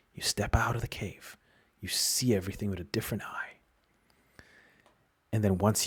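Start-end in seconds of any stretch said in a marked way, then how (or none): 0.1-3.6 hushed whisper. Acted. Describing hearing the inner voice.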